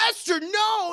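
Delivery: high mock-horrified cry; imitated panicked refusal. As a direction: cry it out high as an appalled dad. Imitates father refusing Esther.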